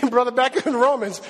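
usual speaking voice

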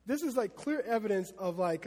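usual speaking voice

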